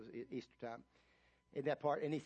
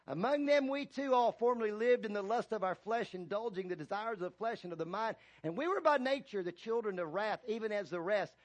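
louder and higher, building sermon cadence; full-force preaching; 6 semitones higher, 6 dB louder than usual. Real, not acted. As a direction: preach it out, stretching key words.

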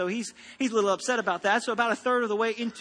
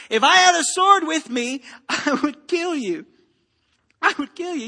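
angry threat; 5 semitones higher, 7 dB louder than usual. Acted. Balaam threatens the donkey.

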